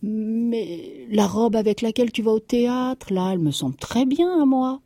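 a husband fumbling for a reassuring answer; stammering. A narrator's reading. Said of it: "il balbutia".

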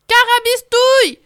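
loud, high outraged yell; howling wolf. Acted. Wolf yelling at the contest judges. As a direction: shout it like a cheated wolf. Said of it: "hurle un loup couleur citrouille".

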